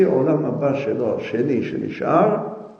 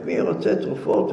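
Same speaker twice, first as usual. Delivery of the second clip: ordinary delivery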